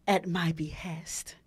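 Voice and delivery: slow hushed whisper. Real, not acted. Host savoring her favorite word, whispered slowly. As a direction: breathe the phrase like a treasure.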